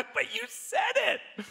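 slow, high, laughing reply; laughing retort. Retold or imitated. Husband answering his wife, slow laughing retort. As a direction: laugh through the comeback.

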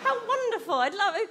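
high, loud, fake enthusiasm; sarcastic gushing. Comedian mocks a dance student, fake high delight. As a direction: gush sarcastically over dance.

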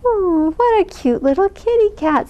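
high, cooing voice of a lady charmed by a kitten; sweet lady. Acted. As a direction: coo it up high like she's found a lost kitty. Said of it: "said the lady".

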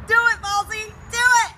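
shouting. Real, not acted. Urging friend to pour.

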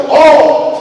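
loud, high "oh"; shocked shout at the slam; outraged yell. Retold or imitated. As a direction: exclaim in comic outrage. Reaction to door slam.

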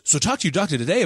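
quick, high ad-spokeswoman imitation; mock upbeat pharmaceutical ad read; energetic commercial voice. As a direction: chirp it like a drug commercial tagline. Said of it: "they have this really energetic voice".

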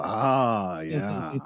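drawn-out, satisfied realisation; knowing recognition. Acted. Podcaster imitating a comic book fan, knowing.

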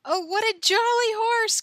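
high, loud exclamation of delight; delighted cry. A narrator's reading. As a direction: cry out with glee, voice leaping up. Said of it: "cried Pippi".

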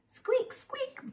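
tiny high squeaky mouse; mouse squeak. Acted.

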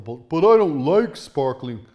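loud, slow, whiny mock voice; mocking whiny critic. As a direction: sneer out the typical excuse. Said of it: "he replied with the typical".

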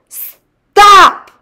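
high, very loud yell; called-out shout. A narrator's reading. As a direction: shout it from the air to halt intruders. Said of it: "called the tiny Mister Gregg".

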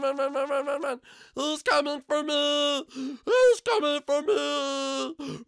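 very high, loud playful shrieks; full-volume panic, stretching into wails; panicked screaming. Pretend. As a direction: scream in mock terror while fleeing. Chased by the seeker.

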